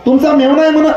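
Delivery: high, eager asking voice; a nosy neighbour pressing the question; high-pitched questioning villager; curious villager. Acted. Acted dialogue, questioner.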